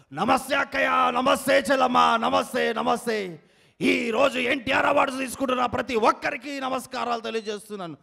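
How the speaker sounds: loud, high rally greetings; politician impression. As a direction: call out to sisters like a campaigning leader. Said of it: "unique mimicry concept". (అక్కచెల్లెళ్లను బిగ్గరగా పలకరించే నాయకుడు)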